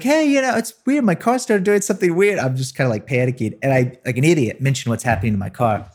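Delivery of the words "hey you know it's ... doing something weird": high, innocent little voice, pitched well up; mock innocent kid. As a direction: play dumb in a high nervous voice. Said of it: "it was like".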